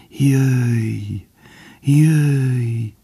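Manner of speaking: high, drawn-out call like faint birdsong; longing, tender call. A narrator's reading. The doe calling Bambi from afar, longing and tender. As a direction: call "come" longingly, stretched and delicate. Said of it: "Világosan hangzott fel újra, finoman, mint halk madárcsicsergés, vágyakozva".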